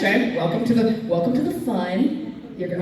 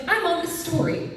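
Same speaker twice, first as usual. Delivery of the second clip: tag; 9 semitones higher than usual. Retold or imitated. Mimicking her own distracted focus.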